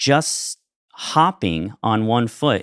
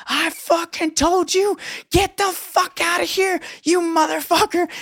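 high, furious mock scream of an old clerk; imitating a clerk losing it, high and wild. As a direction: shriek it like a crazed old clerk. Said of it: "he starts screaming".

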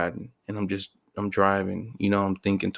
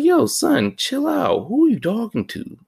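high, loud call-out to himself; high mock scold at his own inner voice; high scolding self-talk; 4 dB louder than usual. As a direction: snap yourself out of it, pitched way up. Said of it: "And I sound crazy right now".

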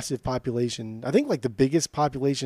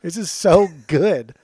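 laughing with delight; 4 semitones higher, 6 dB louder, slower than usual. Real, not acted. Gushing over favourite song.

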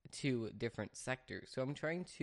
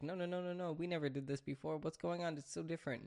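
higher-pitched, lightly voiced protesting dog; a hint of a bewildered pet's voice, pitched up; confused dog; 4 semitones higher than usual. Dog protesting to owner about the skipped walk, lightly voiced confusion. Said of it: "he doesn't understand this because he's like".

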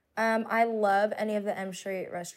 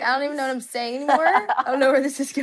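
mocking friend; 5 semitones higher, 7 dB louder, faster than usual. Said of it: "She's like".

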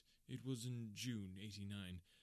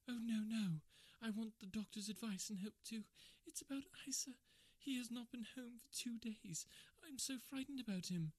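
much higher, anxious woman's voice; frightened Kate; 12 semitones higher than usual. Acted. Kate begging the doctor for help, high frightened voice. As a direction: play Kate pleading, full of fear.

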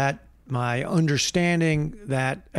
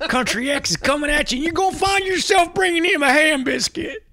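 hammed-up menace; 14 semitones higher, 5 dB louder than usual. Spooky mock threat about Country X.

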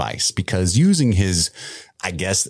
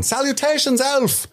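much higher, ringing call. Retold or imitated. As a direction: shout it through the streets. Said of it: "They keep running through the town".

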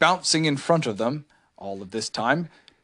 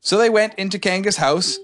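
ordinary delivery

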